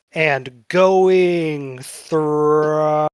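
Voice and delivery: GOING THRI- stretched very slowly; drawn out. Acted. Salesman cutting the party off, going thri- drawn right out. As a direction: pull out each word of "going thri-". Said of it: "really pulling out the gr-, going thrice".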